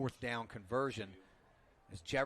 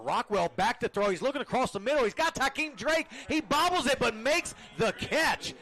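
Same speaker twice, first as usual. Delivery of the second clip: rising excited play-by-play, higher and louder; esports caster; 9 semitones higher, 8 dB louder than usual. Acted. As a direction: call the play fast and loud as it unfolds. Live call of pass play.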